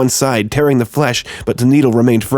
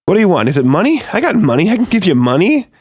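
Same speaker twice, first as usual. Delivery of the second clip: frightened pleading; 7 semitones higher than usual. A narrator's reading. Terrified man pleading with attackers.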